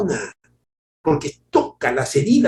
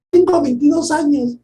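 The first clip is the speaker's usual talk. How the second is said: put-on higher, louder girlish reply; imitated young woman; 13 semitones higher, 6 dB louder than usual. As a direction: play the tearful fan answering. Fan answers reporter.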